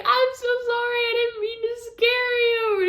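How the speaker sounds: very high, pleading mock apology; imitated pleading apology. Wife imitating husband apologizing for scaring her, high pleading.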